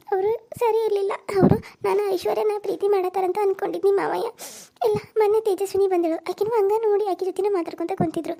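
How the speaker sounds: weeping daughter-in-law pouring out her hurt; crying. Acted. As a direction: sob out the complaint, voice breaking. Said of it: "ಅಯ್ಯೋ, ಅಳ್ಬೇಡ".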